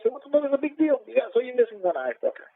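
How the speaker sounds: high, insistent ribbing; retold teasing dismissal. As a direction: brush it off in a high, incredulous pitch. Acted teasing dismissal of lost eye.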